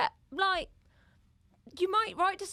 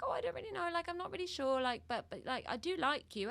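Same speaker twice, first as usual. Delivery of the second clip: mock unsure reply; 8 semitones higher, 3 dB quieter than usual. Quoting unsure woman.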